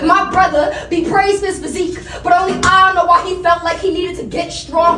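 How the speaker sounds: loud, high, driving slam poetry; slam poetry performance. Acted. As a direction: perform it to the whole room. Performing slam poem on stage.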